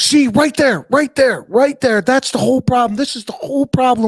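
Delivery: high-pitched mock tirade; hammed-up outraged complaint; imitating screaming customer. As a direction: play an irate customer, pitch way up, hammering each phrase. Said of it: "just screaming".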